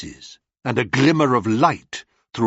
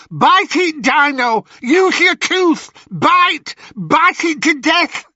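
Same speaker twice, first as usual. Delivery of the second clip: high, loud crone screech; vicious hag; 5 dB louder than usual. Acted. Ennio urging Dino to bite, high crone screech. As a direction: urge the kill like a savage witch.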